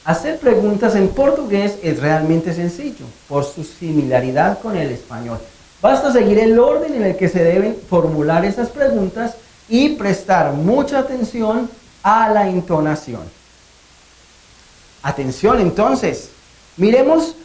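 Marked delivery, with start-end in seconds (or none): none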